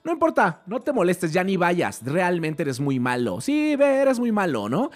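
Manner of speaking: high, mocking sarcastic jab; sarcastic socia. Partner provoking Buster with mock sarcasm, high voice. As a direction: needle him until he snaps back. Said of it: "Y aquí ella se lo dice".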